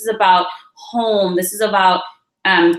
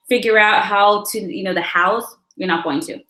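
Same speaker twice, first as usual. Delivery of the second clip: ordinary delivery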